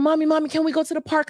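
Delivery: put-on high, fast kid voice nagging mom; child begging, high and rushed; eager child asking. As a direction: pester mommy like an excited little kid. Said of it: "So when they come".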